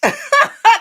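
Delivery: bursting out in a high, loud laugh; happy squealing laugh; high delighted laugh. Real, not acted. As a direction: let a delighted laugh ring out. Laughing at viewer comment.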